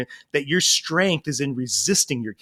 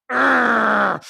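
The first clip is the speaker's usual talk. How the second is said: loud, raised growl; little kid's growl; 7 semitones higher, 6 dB louder than usual. Retold or imitated. Small son growls when asked to tidy, loud mock growl. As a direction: imitate a small boy growling at his dad. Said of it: "growling at me".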